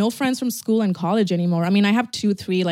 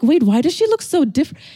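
a bit higher, louder surprised question; retold surprise; 6 semitones higher, 7 dB louder than usual. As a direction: repeat the disbelief more lightly. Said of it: "I'm like".

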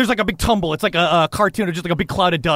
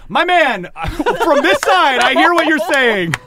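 higher, louder call from afar; calling across; 8 semitones higher, 4 dB louder than usual. Acted. Companion calls from across the canyon, loud friendly shout. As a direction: shout over the canyon with a grin. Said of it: "Thick Jame call".

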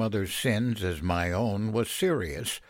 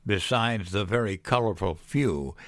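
ordinary delivery, nothing marked

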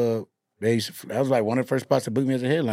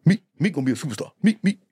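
quoting Charlie Mack; faster than usual. Retold or imitated. Charlie Mack quoted hyping [name].

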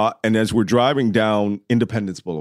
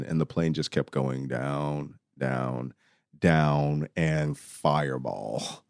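slow, soft and low; quiet, drawn-out dread at the crash; hushed, grave; 6 semitones lower, 8 dB quieter, much slower than usual. Real, not acted. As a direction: let the voice sink slowly with each word. Recounting the crash he witnessed.

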